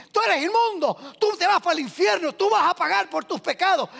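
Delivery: high, forceful condemnation; accusing. Acted. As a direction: pronounce judgement like the accusing law. Voices the condemning law.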